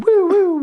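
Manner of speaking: high whooping siren noise; imitated police siren. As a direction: mimic a squad car's whoop-whoop. Imitates police siren before being pulled over.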